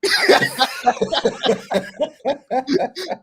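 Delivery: big high-pitched burst of laughter; loud high laugh. Real, not acted. Host laughs at the prank.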